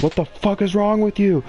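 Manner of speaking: exasperated high retold yell without the volume; retold pissed-off outburst. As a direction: re-enact being pissed off, pitch shooting up. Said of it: "I'm so fucking pissed".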